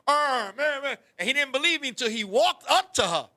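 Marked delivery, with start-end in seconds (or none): 0.1-1.0 high mock moan with half-formed words; imitated deaf man's dismay. Deaf Bear learning she likes women, imitated dismay. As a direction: mimic a protesting wail. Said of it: "this guy is deaf".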